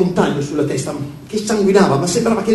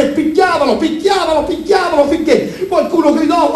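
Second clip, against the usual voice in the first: mounting frenzy; 9 semitones higher than usual. A narrator's reading. Narrator builds to beating climax.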